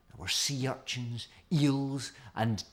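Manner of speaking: ordinary delivery, nothing marked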